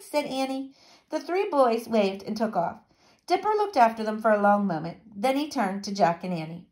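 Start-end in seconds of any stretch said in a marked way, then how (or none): none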